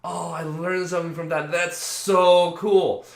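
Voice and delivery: slow, loud, drawn-out wonder; delighted realization. Pretend. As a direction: marvel out loud at a lesson learned. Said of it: "I can actually be like".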